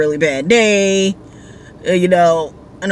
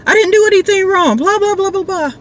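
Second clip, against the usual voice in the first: freaking out; 13 semitones higher than usual. Retold or imitated. Drunk rider panics at the police roadblock, high and frantic. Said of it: "he starts freaking out in the car".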